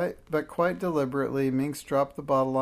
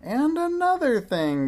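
high, loud witch's scolding; 13 semitones higher, 7 dB louder than usual. A narrator's reading. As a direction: bark it out like a furious witch. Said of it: "went on in the same tone of voice".

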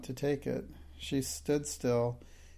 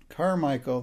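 louder, sudden call for help; loud cry; 2 semitones higher, 7 dB louder than usual. A narrator's reading. Indian gentleman calls out to Carmichael, crying out loudly. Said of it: "cried out loudly".

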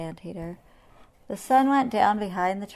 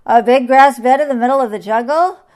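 loud, raised, incredulous question; roared; 5 semitones higher, 16 dB louder than usual. A narrator's reading. Lion finds a bed in the jungle, roared. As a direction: boom it out, astonished. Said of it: "he roared".

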